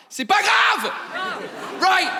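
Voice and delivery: high mock shout. Insisting to the audience that the mistake doesn't matter, high mock shout. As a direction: fling out "c'est pas grave" in a high yell. Repeated phrase, plain then yelled.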